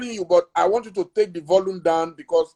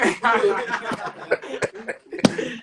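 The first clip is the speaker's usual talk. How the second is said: long laugh after the punchline; amused laughter; 4 semitones higher, 4 dB quieter than usual. Real, not acted. Interviewer recalling the immigration officer, amused laughter. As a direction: break up at your own story. Laughing at his own joke.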